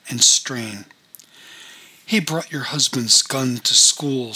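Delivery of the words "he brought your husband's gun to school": plain reading voice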